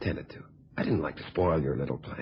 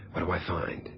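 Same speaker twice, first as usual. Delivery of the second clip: hushed suspenseful whisper; 6 dB quieter than usual. Acted. Suspense in his tale.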